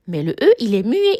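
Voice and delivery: normal talking voice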